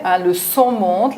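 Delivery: usual speaking voice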